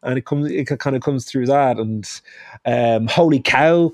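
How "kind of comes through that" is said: usual speaking voice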